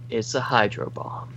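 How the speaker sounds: hushed whispered reveal. Retold or imitated. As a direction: breathe it out as a secret. Echoing the quote in a hush.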